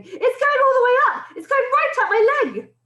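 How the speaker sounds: high, loud panicky yell. A narrator's reading. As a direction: yell it high, in rising alarm. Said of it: "the man was yelling".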